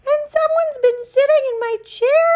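very high, loud cub squeal; squeaky baby bear complaint; wee small Baby Bear. Acted. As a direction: cry out as a tiny upset bear. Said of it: "cried the wee baby bear in his wee small voice".

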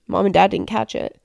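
usual speaking voice

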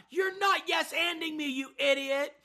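put-on a son yelling at his older brother; kid yelling. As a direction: bark it like a bossy little kid. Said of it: "He yelled at his older brother".

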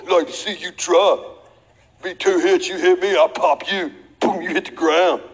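cocky taunting bully, with a laugh; talking trash. Retold or imitated. Retold bully taunting the preacher as a boy. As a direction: sneer the challenge like the kid bully. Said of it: "He's talking trash".

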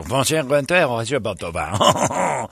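slow mock French greeting; fake French. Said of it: "act like I speak French".

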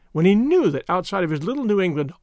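usual speaking voice

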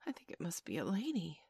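soft, hesitant fear; hushed and mildly frightened; scared voice. A narrator's reading. Slightly realising what was shot, quietly scared. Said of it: "he said in a scared voice".

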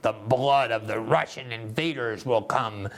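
slow, menacing mock villain voice; slow theatrical supervillain threat; James Bond villain. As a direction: ham it up like a Bond baddie. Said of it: "talking like a James Bond villain".

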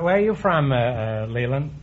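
usual speaking voice

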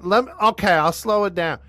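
high mock-Southern voice; lightly put-on Southern twang; Southern accent. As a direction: imitate a Southerner giving in. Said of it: "you don't talk Southern".

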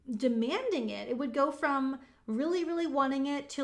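ordinary talk

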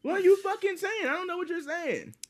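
loud, high-pitched incredulity; hammed-up "what on earth" reaction; baffled outburst. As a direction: act out exasperated confusion, starting loud and shooting up. Acting out total bafflement.

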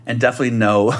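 ordinary talk, nothing marked

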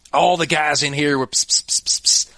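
retold clerk hissing psst, psst, psst at him; little clerk girl mimicking the guys' psst sounds; imitated psst whispers. Storyteller mimics clerk's psst psst to podcast friend, hissed. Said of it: "And she was like".

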